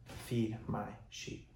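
very soft and slow, almost whispered; hushed solemn. Acted. Jesus charges Peter for the third time, hushed. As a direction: let each word land quietly, slowly. Solemn close of scripture reading.

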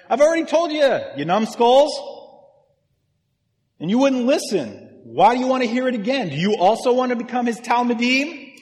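high, loud, fed-up outburst; exasperated. Acted. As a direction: snap back at the questioners in exasperation. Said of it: "he's exasperated".

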